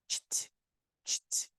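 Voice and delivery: soft voiceless "cht", consonants only; just the ending "cht", no vowel; isolated CH-T sound. Shown as a demonstration. Teacher isolating the hard ending for students, voiceless "cht". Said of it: "você tem que falar".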